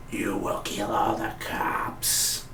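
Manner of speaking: slow, quiet smoker's voice; imitating Zoom, slow and soft. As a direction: rasp the order out like a heavy smoker. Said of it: "Sound like a smoker's voice".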